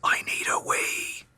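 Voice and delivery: urgent little whisper. Acted. Husband whispering back to wife, urgent whisper. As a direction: whisper it so nobody in the row hears. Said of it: "you whispers back".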